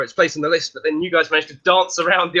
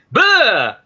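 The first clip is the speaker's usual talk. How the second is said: higher, louder jump-scare bah; hammed-up scare bah; playful scare shout bah; 7 semitones higher, 5 dB louder than usual. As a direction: leap out with a loud bah. Meringue jumps out scaring.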